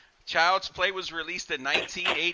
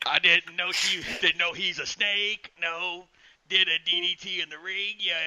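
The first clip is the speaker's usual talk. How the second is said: Jake voice; 4 semitones higher than usual. Retold or imitated. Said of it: "my Jake voice to it".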